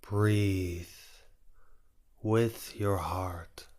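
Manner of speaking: normal talking voice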